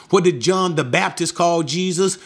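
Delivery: ordinary talk, nothing marked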